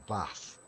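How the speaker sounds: "bath" with a short a, drawn out; northern English bath. Shown as a demonstration. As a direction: say "bath" slowly with the a of "cat". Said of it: "See, where I come from, we say".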